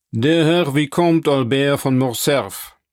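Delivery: slow, formal announcing of a guest; servant's announcement. A narrator's reading. Valet reports a visitor to the banker's company, slow announcement. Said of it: "meldete der Kammerdiener".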